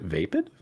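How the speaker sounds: "vapid" said as VAY-pid; "vapid" rhyming its first syllable with day; vape-like first syllable. Real, not acted. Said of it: "I think you're confusing vape".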